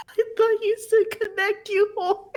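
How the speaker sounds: high giggling through the words; laughing. Real, not acted. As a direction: laugh while explaining. Laughing at misheard command.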